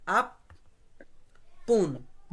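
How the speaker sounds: "আপ-, পুন-" as two isolated syllables. Shown as a demonstration. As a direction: leave a long gap between "আপ-" and "পুন-". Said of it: "এটা syllable আকারে পড়বো আমরা".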